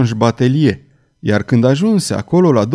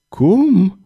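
startled question, pitched high; disbelieving mumble, voice jumping up; stammered shock; 7 semitones higher than usual. A narrator's reading. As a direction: gasp out a stunned question. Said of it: "bolborosi Farnes".

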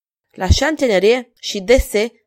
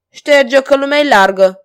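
high, loud dismissive shout; 6 semitones higher, 9 dB louder than usual. A narrator's reading. Courtier warning off the stranger, shouting. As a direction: shout him away. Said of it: "strigă Sansac".

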